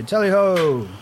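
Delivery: booming, high hunting cry; hearty booming country squire. Acted. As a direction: call it out like a squire leading the hunt. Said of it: "then I point in the direction of the tracks and say".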